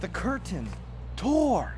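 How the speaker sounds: "tore" bursts out high and loud; thrilled storyteller's big moment; dramatic reveal. A narrator's reading. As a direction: land "tore" like a sudden rip.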